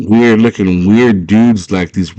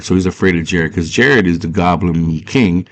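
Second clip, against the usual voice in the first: plain reading voice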